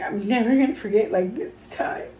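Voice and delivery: speaking through happy tears; real tears, pushing the words out; tearful. Real, not acted. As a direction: voice catching with overwhelmed joy. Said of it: "I'm already crying".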